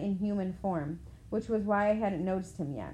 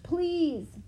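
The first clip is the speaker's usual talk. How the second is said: high strained croaking plea; a faint, pitched-up croak of please; 9 semitones higher than usual. A narrator's reading. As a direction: beg weakly, voice cracking high. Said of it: "I croaked".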